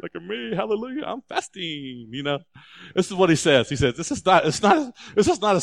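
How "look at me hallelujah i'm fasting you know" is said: soft mock boasting, dragged out; mock show-off. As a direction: parody a smug faster. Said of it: "thinking about that post tomorrow".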